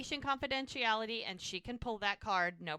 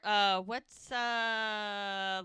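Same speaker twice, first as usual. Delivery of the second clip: drawn-out, halting hesitation; dumbfounded; much slower than usual. Retold or imitated. Jack stands there staring at the two women, dumbfounded. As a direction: stall on each filler, lost for words.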